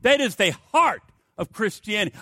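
usual speaking voice

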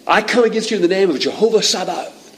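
high-pitched challenge, without full volume; a held-back imitation of a battle cry; mock scream. David challenges Goliath in God's name, mock scream. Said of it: "I think David just screams it at him".

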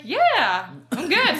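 high, laughing "I'm good"; high chirpy dismissive answer; breezy reply. Retold or imitated. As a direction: play it off casually with a laugh. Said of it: "I was like".

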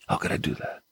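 whispered self-reproach; remorseful whisper. Acted. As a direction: ask it to yourself in a broken hush. David voiced in remorse.

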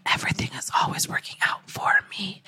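whispered affirmation, fast and hushed. Shown as a demonstration. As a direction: breathe the words out under your breath. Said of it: "So repeat after me".

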